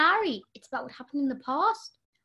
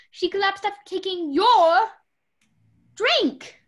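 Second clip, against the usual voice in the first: louder, rising accusing voice; loud accusation; 4 semitones higher, 9 dB louder, slower than usual. Acted. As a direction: pin the blame on them, loud. Accuses over the drink.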